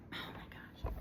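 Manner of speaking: breathy unvoiced whisper. Real, not acted. Tarot reader reacting to herself, whispering. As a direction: whisper it to yourself. Whispering while handling cards.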